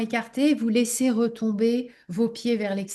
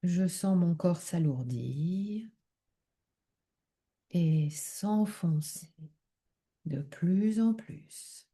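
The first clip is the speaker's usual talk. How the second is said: calm relaxation leading, unhurried and softened; guided meditation; 3 semitones lower, 5 dB quieter than usual. Acted. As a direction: guide them gently into deep rest, phrase by phrase. Leads a yoga nidra relaxation.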